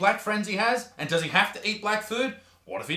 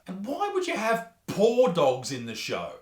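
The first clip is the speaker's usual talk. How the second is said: normal talking voice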